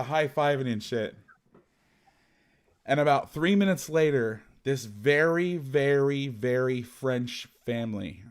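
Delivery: usual speaking voice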